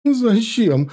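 high, loud tearful question; scared wife asks why they must leave; frightened sob. A narrator's reading. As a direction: ask through tears in panic. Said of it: "рыдая спросила перепуганная Мария".

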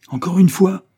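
high, loud impatient cry; acute impatience. A narrator's reading. Isabelle cries out at Levison's persistence, very impatient. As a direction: burst out exasperated. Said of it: "en proie à la plus vive impatience".

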